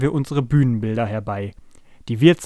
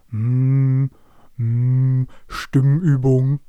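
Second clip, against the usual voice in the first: slow, drawn-out vocal exercise hum; droning voice-exercise character; humming; 3 dB louder, much slower than usual. A narrator's reading. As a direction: hum it out like a voice warm-up. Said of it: "summte er immer vor sich hin".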